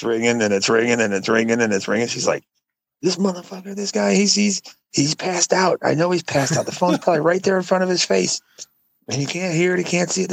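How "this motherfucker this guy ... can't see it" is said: high mock rant about a passed-out guy; high, fed-up imitation of her grumbling; exasperated sister-in-law. Sister-in-law retold on the phone.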